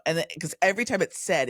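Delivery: ordinary delivery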